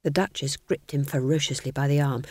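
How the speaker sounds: ordinary delivery, nothing marked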